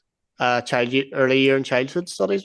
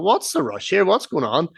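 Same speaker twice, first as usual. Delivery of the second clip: higher, swooping puzzled questions; bewildered protest; 8 semitones higher than usual. Retold or imitated. Coming downstairs to the family photo, retold bewildered protest. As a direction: demand an explanation, pitch leaping up.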